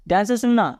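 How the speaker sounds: ordinary talk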